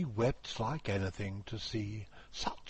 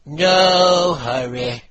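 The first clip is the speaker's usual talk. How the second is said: carpenter voice; 7 semitones higher, 17 dB louder, slower than usual. Acted.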